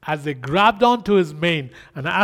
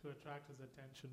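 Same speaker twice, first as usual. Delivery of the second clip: much softer, pulled back from the mic; quieter; 3 semitones lower, 16 dB quieter than usual. Real, not acted. Pastor leading prayer, suddenly much softer and gentle. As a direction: drop right down and speak gently. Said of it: "Just lower my mic".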